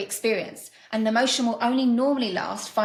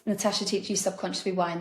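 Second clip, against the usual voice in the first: normal talking voice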